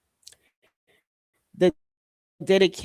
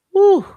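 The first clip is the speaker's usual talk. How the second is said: short, loud, high hoot; high whoop; 10 dB louder than usual. Real, not acted. Vlogger reacting to the situation, high whoop. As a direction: burst out with a high "whew". Exclaims between remarks.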